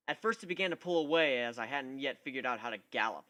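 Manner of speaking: plain reading voice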